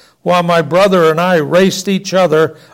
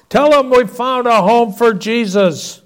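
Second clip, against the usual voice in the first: higher, calling voice; called down; 6 semitones higher than usual. Acted. Father calling down the steps to the child, higher. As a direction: call it down the stairs. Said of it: "he called down the steps".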